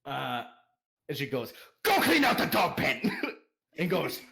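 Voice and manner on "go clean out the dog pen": high, loud bossy yell; loud, scolding wife; angry order. Acted. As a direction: shout the order at a husband. Wife ordering her husband off.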